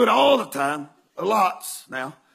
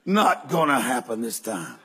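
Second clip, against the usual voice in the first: lower deadpan agent; 11 semitones lower than usual. Retold or imitated. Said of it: "She said".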